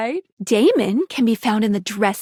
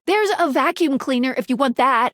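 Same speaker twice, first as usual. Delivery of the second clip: normal talking voice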